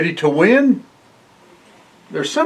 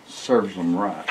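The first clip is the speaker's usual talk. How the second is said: quiet satisfied aside; 9 semitones lower, 9 dB quieter than usual. Real, not acted. Aside about cheaters losing.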